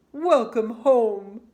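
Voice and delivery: loud, slow father voice; father's welcome. A narrator's reading. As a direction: boom out a slow welcome as dad. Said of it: "said her father".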